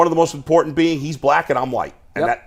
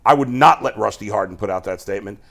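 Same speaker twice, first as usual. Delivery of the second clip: ordinary talk, nothing marked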